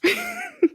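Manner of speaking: squeaky burst of laughter; high laugh. Real, not acted. Laughing at the joke.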